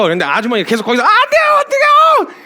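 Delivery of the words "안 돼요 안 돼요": much higher, louder mock shriek; panicked wail. Woman refusing to let go of the brake, mock shriek. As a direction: wail in terror at rolling back. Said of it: "막 이러고 이제 이러고 있으면".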